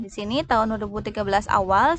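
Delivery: usual speaking voice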